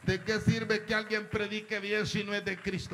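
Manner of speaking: fervent sermon questions; preaching. Acted. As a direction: hammer the questions home. Preaching force.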